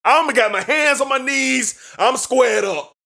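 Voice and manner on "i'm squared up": ordinary talk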